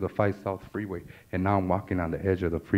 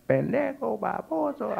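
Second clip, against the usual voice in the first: high-pitched mock scolding; mother yelling insults; 13 semitones higher, slower than usual. Mother sees son drop through ceiling, yells insults. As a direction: hurl the insults like a shocked mom. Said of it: "as she's yelling at me".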